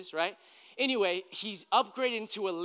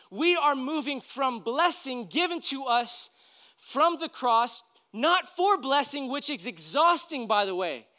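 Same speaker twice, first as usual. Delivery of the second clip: higher, louder, emphatic exhortation; preaching at full force; 6 semitones higher, 5 dB louder than usual. Acted. Pastor urging listeners to stop fighting for blessing, forceful preaching. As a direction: drive the closing point home.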